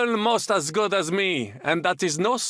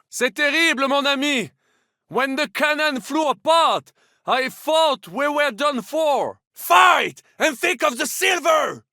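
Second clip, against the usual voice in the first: higher and louder, shaken and urgent after the blast; alarmed battle shout; 8 semitones higher, 4 dB louder than usual. Acted. Knight shouting to comrades amid cannon fire, alarmed and loud. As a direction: yell over the din of battle.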